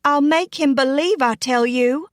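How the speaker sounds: high, heated insistence; insistent. A narrator's reading. Girl following doubting cousin into room, insisting. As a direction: insist on it, pitched right up.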